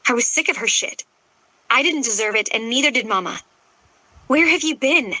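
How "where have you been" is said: sharp, higher snap; snapping. A narrator's reading. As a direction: snap the question. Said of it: "I snapped".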